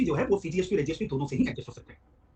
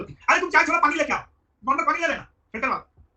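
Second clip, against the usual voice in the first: higher, louder shouted order; calling out orders; 9 semitones higher, 7 dB louder than usual. Retold or imitated. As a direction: bellow for tea across the shop. Shopkeeper yelling orders to staff.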